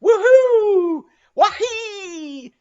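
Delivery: very high, loud whooping scream; war whoop. A narrator's reading. Harpooneer answering Stubb with a whooping war cry. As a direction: let out a wild war cry.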